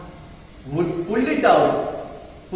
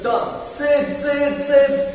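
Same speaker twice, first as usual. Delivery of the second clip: calling out; 7 semitones higher, slower than usual. Acted. Tom calls sleeping Sid.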